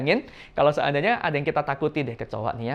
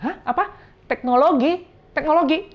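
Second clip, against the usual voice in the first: high, rising query; puzzled questioning; 13 semitones higher than usual. Retold or imitated. Ai mishears the word teologi, puzzled and questioning. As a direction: ask it like you misheard, pitched way up. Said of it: "Ai-nya mungkin nggak ngerti juga".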